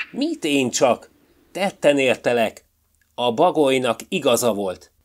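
the fox shouting at the magpie; accusing shout. A narrator's reading. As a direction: call him out triumphantly. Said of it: "Karcsi kiabálására".

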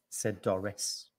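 plain reading voice

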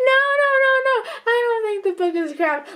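high, laughing no no no; half-crying with laughter; laughing denial. Real, not acted.

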